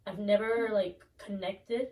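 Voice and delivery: ordinary delivery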